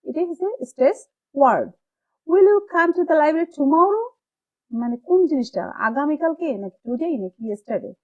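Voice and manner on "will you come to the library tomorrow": tomorrow lifted, whole line high and loud; stress on tomorrow. Shown as a demonstration. Teacher reading the question aloud, stressing tomorrow. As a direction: ask it slowly, pressing on tomorrow. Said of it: "stress দিব, অর্থাৎ tomorrow".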